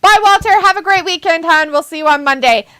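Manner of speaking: high, bright cheerful send-off; cheery farewell to a viewer; sing-song goodbye. Real, not acted. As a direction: wave goodbye in a lilting voice.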